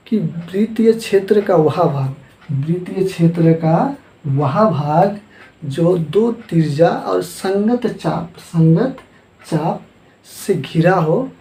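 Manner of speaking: slow, measured definition with drawn-out phrases; slow dictation. Real, not acted. Teacher dictates the definition word by word. (धीरे-धीरे परिभाषा लिखवाते हुए)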